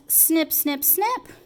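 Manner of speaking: playful snip sounds; scissor snips. Pretend. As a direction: snip snip, rising on the last. Cutting with magic scissors.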